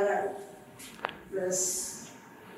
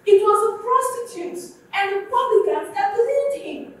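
rising, louder preacher's cry building through the point; preaching at full force; 7 semitones higher, 13 dB louder than usual. Acted. Pastor pressing the congregation to see it, full-force preaching. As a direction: drive it home to the congregation, louder and higher.

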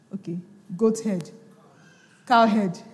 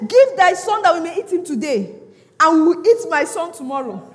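loud, raised scripture quote; preach at full force; 5 semitones higher, 7 dB louder than usual. Real, not acted. Preacher quotes the woman's bargain to church, loud declamation.

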